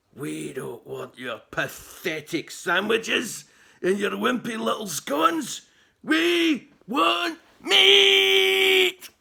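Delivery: starts firm, ends loud, high and drawn out; building to a furious roar. A narrator's reading. Chief glowers at the boy, growing to a furious roar. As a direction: build from growl to a roaring demand. Angry chief demands meat.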